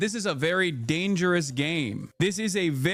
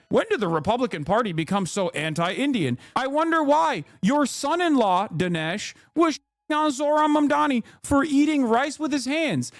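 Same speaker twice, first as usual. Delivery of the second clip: sarcastic imitation of a fake-innocent question; mocking Dinesh D'Souza; 6 semitones higher than usual. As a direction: play the fake surprise, a bit hammed-up. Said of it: "Dinesh D'Souza the other day was like".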